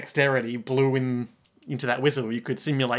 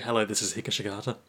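quick, quieter formal phone greeting; 5 semitones lower, 6 dB quieter, much faster than usual. Retold or imitated. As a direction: answer like a polite receptionist. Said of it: "he answers very formally".

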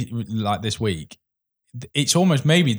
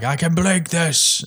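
higher, slow mock Boris; imitating Boris; 7 semitones higher, much slower than usual. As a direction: drag it out like the cocky hacker. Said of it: "And he's like".

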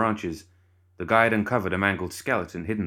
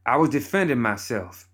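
higher, louder, spat out; spat angrily; 5 semitones higher, 3 dB louder than usual. Acted. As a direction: bite it out at him. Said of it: "Bernadette spat".

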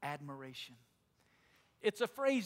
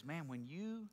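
hushed; 4 semitones lower, 8 dB quieter, slower than usual. Retold or imitated. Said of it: "he says".

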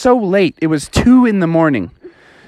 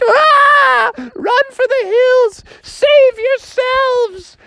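much higher, slow crying shouts; panicked kids crying; much slower than usual. Acted. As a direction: wail like terrified kids. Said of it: "they all were crying".

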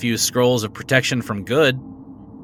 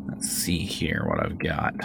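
whispered muttering while searching; whispering; 7 semitones lower, 8 dB quieter than usual. Acted. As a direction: whisper to yourself while rummaging. Shopkeeper rummages through stock.

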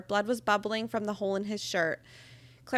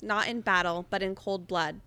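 ordinary delivery

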